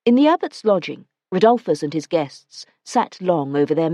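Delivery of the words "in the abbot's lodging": ordinary delivery, nothing marked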